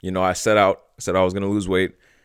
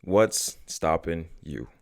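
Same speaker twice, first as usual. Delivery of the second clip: very slow, each word drawn out; slow and deliberate; much slower than usual. Shown as a demonstration. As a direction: let the question sink in slowly. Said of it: "And really think about this".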